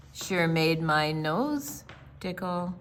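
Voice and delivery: plain reading voice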